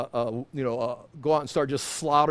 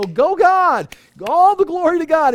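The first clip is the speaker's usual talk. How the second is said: much higher and louder cheering; cheering fan; 11 semitones higher, 9 dB louder than usual. Pretend. Pastor pretending to cheer from the sidelines, high and loud. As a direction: cheer God on from the stands. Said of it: "the cheering section".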